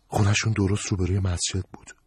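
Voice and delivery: plain reading voice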